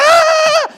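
high loud imitated shriek of fright; daughter's startled scream at a fake spider; mock shriek. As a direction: shriek like a scared teen. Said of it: "She's like".

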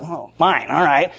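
low, muted mock sulk; grudging annoyance. Person told they're wrong, grudgingly conceding. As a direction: give in grudgingly, under the voice. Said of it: "You're mad at them".

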